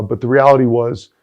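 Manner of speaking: ordinary delivery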